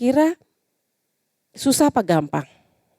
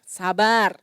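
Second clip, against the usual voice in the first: softer exhaled sabar after a breath; calm sigh; 3 semitones lower, 6 dB quieter than usual. Shown as a demonstration. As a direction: breathe out and say it gently. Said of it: "Ayo bilang". (sabar diucapkan pelan sambil menghela napas)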